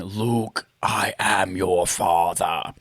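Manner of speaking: low, slow Vader line; deep menacing villain, slowly; Darth Vader voice. Retold or imitated. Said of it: "the line".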